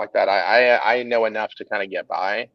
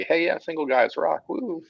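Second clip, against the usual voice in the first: mock party guy; 7 semitones higher than usual. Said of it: "I'd probably be".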